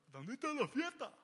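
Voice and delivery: light high party voice; partying king. Retold or imitated. King Agag thinks about the party, lightly voiced. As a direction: tease him as a clueless reveler. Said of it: "El rey Agag que estaba pensando".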